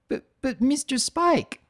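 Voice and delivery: high, halting filly voice; stuttering filly. Acted. Shy new filly stammering to Spike, high and nervous. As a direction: stammer it like a nervous little girl. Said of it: "Starbright stutters".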